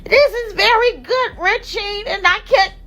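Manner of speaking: high, slow mocking imitation; high mock voice of the critics. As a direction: parody them in a high drawn-out voice. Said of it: "they talking about".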